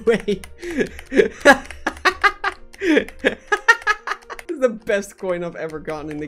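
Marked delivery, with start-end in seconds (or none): 0.5-4.4 loud, high laughing fit; real startled laughter; burst of laughter. Real, not acted. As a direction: crack up out of nowhere. Surprise coin catches him out.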